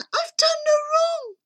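put-on very high little-girl plea; hammed-up frightened squeak; pleading cry. A narrator's reading. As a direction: beg for your life in a tiny high voice. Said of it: "She cried again".

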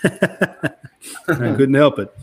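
laughing. Real, not acted. Embarrassed after sneezing on air.

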